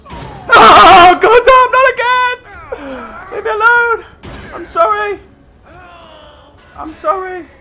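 high, loud terrified yelling; panicked screaming. Real, not acted. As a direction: scream and beg in terror. Monster attacks again.

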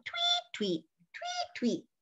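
very high, soft whistled tweets; soft, high bird whistle; chickadee call. Shown as a demonstration. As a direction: pipe it like a chickadee.

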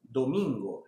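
slow teacher domingo; slow model. Shown as a demonstration. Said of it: "Repete comigo".

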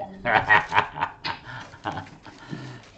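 long laugh at own joke; hearty laugh. Real, not acted. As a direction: burst out laughing after your joke.

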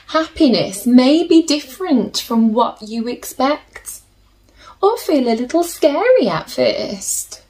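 gentle storybook reading with slow pauses; soothing storybook narration for little listeners; reading to children. A narrator's reading. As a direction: read it like a bedtime picture book. Reads picture book to children.

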